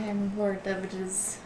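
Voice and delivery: soft in-character line. Acted. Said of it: "I say".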